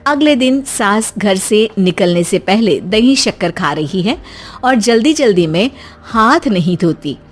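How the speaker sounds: usual speaking voice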